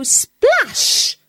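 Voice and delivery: high excited splash. A narrator's reading. Mouse telling of the river plunge, excited. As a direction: make the splash jump out.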